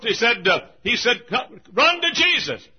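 high, loud pulpit cry; fervent revival exhortation; exhorting preacher. Acted. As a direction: shout it as an urgent command.

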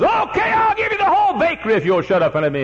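high, loud put-on neighbour voice; worn-out neighbour caving in; exasperated surrender. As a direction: give up just to get some sleep. Neighbour gives in.

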